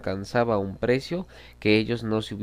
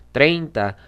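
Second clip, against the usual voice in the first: loud, higher challenge to outbid; raised-voice auction challenge; challenging bid; 5 semitones higher, 8 dB louder than usual. A narrator's reading. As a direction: throw the number out loudly as a dare. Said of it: "con tono que parecía retar".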